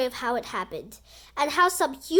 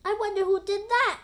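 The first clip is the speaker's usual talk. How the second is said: wondering fan; 7 semitones higher, 4 dB louder than usual. Acted. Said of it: "asked another fan".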